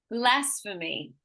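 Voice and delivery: slow "blasphemy", BLAS strongest; stress on first syllable. Shown as a demonstration. Teacher quizzing a student, slow BLAS-phemy in "blasphemy". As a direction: hit BLAS, drop off through "blasphemy". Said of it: "tell me where I'm emphasizing".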